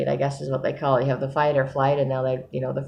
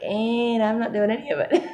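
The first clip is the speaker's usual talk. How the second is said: higher pitched, sheepish confession; deadpan admission; 8 semitones higher than usual. Retold or imitated. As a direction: own up with comic resignation. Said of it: "I'm like".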